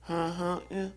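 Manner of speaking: mumbled, wordless carny grunt; garbled carny mumble; carny server. Retold or imitated. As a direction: mimic a server's unintelligible counter talk. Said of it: "He doesn't speak Carney".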